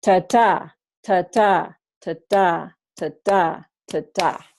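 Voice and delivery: "ta-ta" five times, weak first syllable, stress on the second "ta", each one falling; iambic beat. Shown as a demonstration. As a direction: tap out da-DUM da-DUM like a metronome. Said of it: "pairs of stressed and unstressed syllables".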